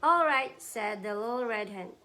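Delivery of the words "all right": loud, high "All right" as the hen; the hen gives in, raised and loud; hen's voice. Acted. Mother reading to child, hen voice loud and high.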